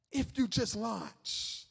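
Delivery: drawn out at half pace; slow, weighty echo; slow hushed repeat. Real, not acted. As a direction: let the line land slowly, word by word. Hushed repeat after the outburst.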